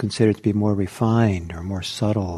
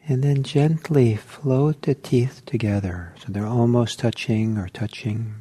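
guided meditation. Acted. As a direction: ease the group into stillness, one gentle cue at a time. Teacher leads a guided meditation.

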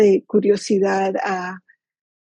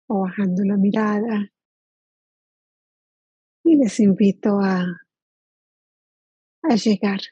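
guided meditation. Acted. Teacher inviting the group to settle into body and present moment, guided meditation. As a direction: lead them gently into stillness, leaving long silences.

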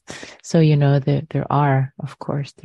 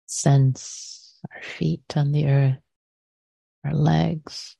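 guided meditation. Real, not acted. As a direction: guide listeners gently into the body, unhurried.

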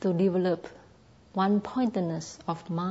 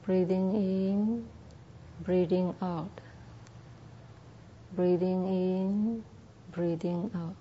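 meditation cue; 5 dB quieter than usual. Shown as a demonstration. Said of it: "demonstrates breath noting for meditators".

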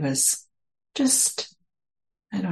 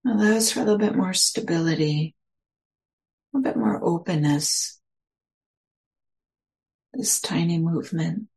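guided meditation. Acted. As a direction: ease listeners into their posture, unhurried.

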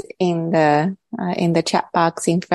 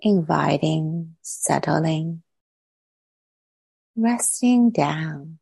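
calm, unhurried meditation voice with long pauses; guided meditation; 3 dB quieter than usual. Real, not acted. Teacher settling the group into body and breath, calm guided meditation. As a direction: guide them gently inward, leaving long silences between phrases.

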